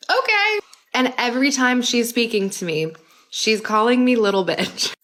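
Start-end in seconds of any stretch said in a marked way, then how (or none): 0.1-0.6 high, small, timid okay; meek okay. Retold or imitated. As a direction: shrink into a nervous yes. Meek reply to bossy grandmother.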